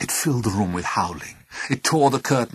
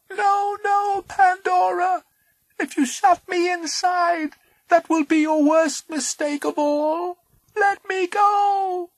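high pleading creature voice; 7 dB louder, slower than usual. Acted.

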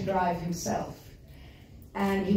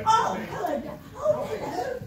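bright, much higher, with a laugh; delighted greeting; 13 semitones higher than usual. Real, not acted. Greeting a little girl she once held as a baby, high and delighted. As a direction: coo hello at the little one with a laugh.

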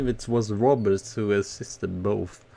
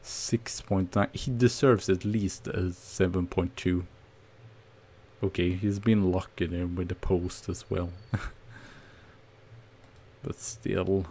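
ordinary talk

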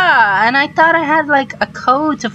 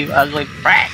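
ordinary delivery

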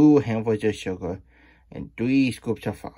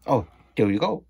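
usual speaking voice

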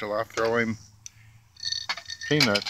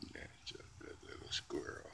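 whispered praise to a squirrel; soft whisper; 22 dB quieter than usual. Real, not acted. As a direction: hush it down to a whisper, close and gentle.

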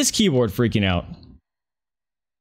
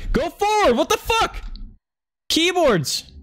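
panicked shriek; slower than usual. Real, not acted. Keyboard glitch in game.

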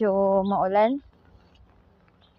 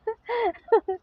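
high laugh; 13 semitones higher than usual. Real, not acted. Laughing at own joke.

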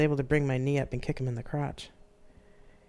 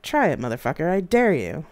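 calm but deadly; 3 semitones higher, 6 dB louder than usual. Acted. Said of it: "in a calm but deadly voice".

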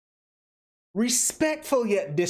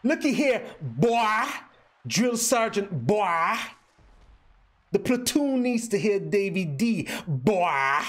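put-on "boy" drawled with a W, southern style; 3 semitones higher, slower than usual. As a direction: call it out like an old southern drill sergeant. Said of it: "You gotta put a W in front of the O".

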